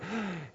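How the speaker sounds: gasp. Retold or imitated. Listener hears something startling.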